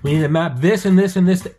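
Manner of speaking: loud, high Australian briefing; Aussie Cossack. Retold or imitated. YouTuber explaining his map, mimicked loud and emphatic. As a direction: declare the plan forcefully. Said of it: "He's like".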